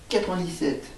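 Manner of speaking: normal talking voice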